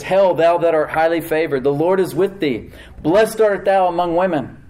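angel's grand proclamation to Mary; proclaiming angel. Retold or imitated. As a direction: announce it like a herald from heaven.

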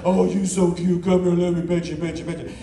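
mock puppy talk, talking down; baby talk. Preacher baby-talking the dogs, patronizing. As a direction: coo like to a little puppy. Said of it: "talking to them like they're little puppies".

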